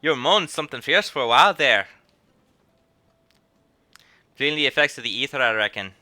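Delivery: a lightly voiced rough country accent, loud and kindly; rough country brogue calling out to a waking lad; gently put-on rural accent; rustic accent. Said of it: "It's a better accent than I thought it'd be".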